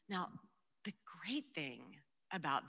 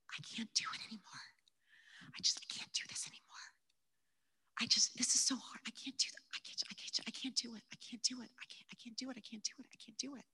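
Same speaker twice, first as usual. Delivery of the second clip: desperate whispered prayer; 5 semitones higher, faster than usual. Retold or imitated. Pleading prayer to the Lord.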